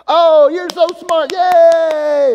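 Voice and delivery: put-on high, loud baby-praise squeal; excited cheering. Grandparents cheering the baby at the dinner table, high excited squeal.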